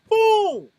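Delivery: a sudden high, loud BOOM like a knockout blow; shouted boom. Retold or imitated. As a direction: slam the word out at full force. Landing the big punch.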